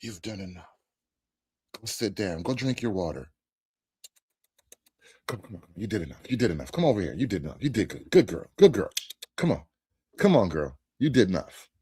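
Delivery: low, hushed coaxing, as if to a pet; mock soothing handler. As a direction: imitate a handler softly talking someone down.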